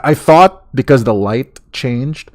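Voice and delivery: usual speaking voice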